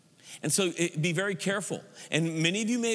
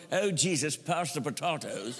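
lower, hammed-up British accent; mock Elizabethan English; 4 semitones lower than usual. God at the heavenly table, mock Elizabethan British accent. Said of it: "God does not speak Elizabethan English".

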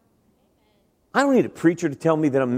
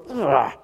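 rough growl of exasperation; frustrated groan. Shown as a demonstration. Preacher showing reaction to a nagging thought, frustrated groan. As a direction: groan away an unwanted thought. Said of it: "you ever have a thought and you go".

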